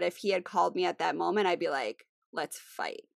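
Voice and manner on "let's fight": low, hushed mock challenge. Telling how she would answer her husband, hushed mock challenge. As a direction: drop to a near-whisper, pretending to pick a fight. Acts out her combative reply.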